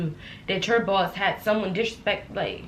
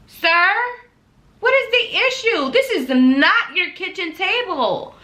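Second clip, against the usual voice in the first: higher and louder, incredulous; retold confrontation; 5 semitones higher, 4 dB louder than usual. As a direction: demand an answer, outraged. Confronting the coworker angrily.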